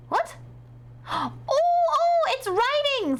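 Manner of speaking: startled high squeal; surprised. Real, not acted. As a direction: caught off guard, yelp it. Startled as the pen writes.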